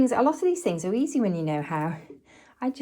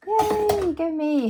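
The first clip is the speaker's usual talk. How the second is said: little cheer; 8 semitones higher, much slower than usual. Real, not acted. Cheering herself after a task.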